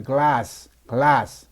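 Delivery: 글라스 with an open ah vowel. Shown as a demonstration. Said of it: "오가 아 발음인데".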